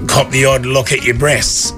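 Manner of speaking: ordinary talk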